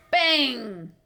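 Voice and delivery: ordinary delivery, nothing marked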